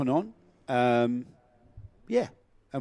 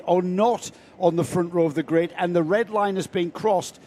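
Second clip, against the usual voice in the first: race commentary; 3 semitones higher, 4 dB louder than usual. Acted. Live play-by-play.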